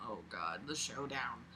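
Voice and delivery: soft, low, dreading aside; quiet dread. Real, not acted. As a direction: mutter it with mock dread.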